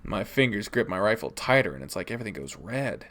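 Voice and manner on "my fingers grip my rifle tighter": plain reading voice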